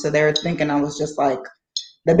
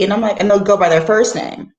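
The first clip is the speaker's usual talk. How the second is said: ordinary delivery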